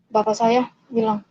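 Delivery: plain reading voice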